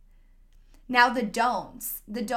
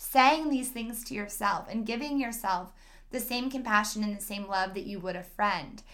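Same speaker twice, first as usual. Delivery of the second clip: ordinary delivery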